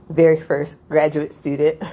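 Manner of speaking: normal talking voice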